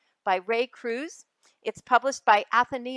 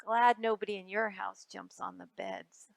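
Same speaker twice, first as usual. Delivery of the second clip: plain reading voice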